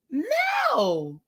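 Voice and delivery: put-on high, drawn-out little-kid "no"; frustrated little child. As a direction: imitate a fed-up child raising his voice. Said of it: "He raised his little voice".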